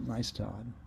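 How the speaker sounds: ordinary delivery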